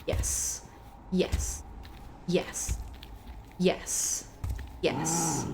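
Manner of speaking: whispered yes, held under breath; tense whispered cheer while sneaking. Real, not acted. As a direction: hiss the yes so the troll won't hear.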